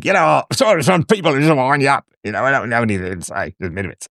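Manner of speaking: imitated caller with a loose Australian accent; vaguely Australian accent. Host imitating a slurred caller, vaguely Australian accent. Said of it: "I went vaguely Australian there".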